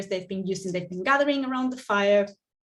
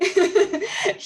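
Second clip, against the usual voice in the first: brief, higher-pitched laugh; light chuckle; 9 semitones higher, 6 dB louder than usual. Real, not acted. Host joking to group about minding fire safety, light laugh. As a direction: let out a short chuckle.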